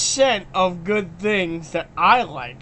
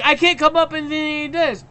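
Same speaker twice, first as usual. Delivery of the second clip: higher, lightly put-on whiny voice; mock Disney; 5 semitones higher, faster than usual. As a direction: whine as the idea-less studio. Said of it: "Disney's like".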